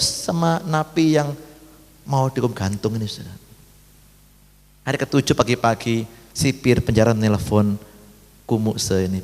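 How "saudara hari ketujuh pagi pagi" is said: normal talking voice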